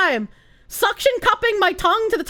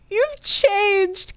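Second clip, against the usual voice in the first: wounded drama; 2 semitones higher than usual. Acted. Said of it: "Give that woman the Oscar".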